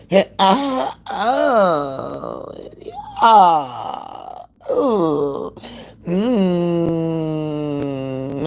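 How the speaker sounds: hammed-up breathy moans and sighs; mocking an extra-sounding woman's moans; mock breathless moaning. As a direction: imitate someone putting on a moaning voice. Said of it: "It's always breathless and moany".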